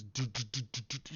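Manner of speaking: soft, rapid "do-do" sound effects; imitated action noises. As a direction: mimic quick dodging with quiet little bursts. Imitates lightsaber movement noises.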